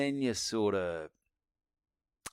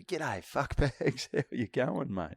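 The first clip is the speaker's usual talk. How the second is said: slow mock greeting; 3 semitones higher, 5 dB quieter, slower than usual. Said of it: "You can say whatever".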